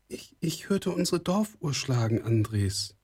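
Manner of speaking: put-on high, hesitant girl's voice; high-pitched girl confiding her worry; uneasy young girl. A narrator's reading. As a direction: stumble slightly, pitched high.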